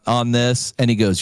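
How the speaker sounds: usual speaking voice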